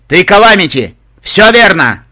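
booming, high-powered shout; thunderous proclamation. Acted. Reaper names Calamity, thundering. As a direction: proclaim it like an earthquake. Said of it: "Его голос потрясал, будто землетрясение".

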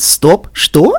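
playfully parroted astonishment; giggly mimicry. A narrator's reading. Pinkie mimics the listener, giggly. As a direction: repeat someone's shock teasingly. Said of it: "хихикнула Пинки".

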